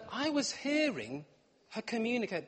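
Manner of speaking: normal talking voice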